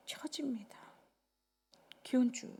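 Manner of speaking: sudden unvoiced whisper on the last word; whispered drop. Pastor to the congregation, whispering the sagging. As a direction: let it sink into a whisper.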